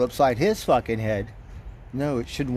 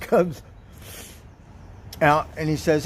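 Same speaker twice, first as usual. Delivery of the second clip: ordinary delivery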